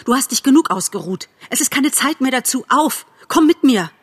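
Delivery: high, insistent commands; urgent shouting. A narrator's reading. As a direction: urge a fallen friend to get up. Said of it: "schrie Bambi". (drängend, hoch gerufen)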